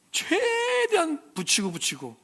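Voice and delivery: high, slow, strained squeeze; straining to squeeze. Retold or imitated. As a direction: stretch it out like squeezing through a gap. Squeezing out of a parked car.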